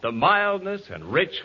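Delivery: ordinary delivery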